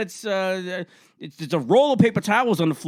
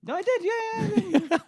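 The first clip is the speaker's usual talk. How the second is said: much higher, laughing yeah; laughing squeak; 4 dB quieter than usual. Real, not acted. Caught lying, he squeaks a laughing denial.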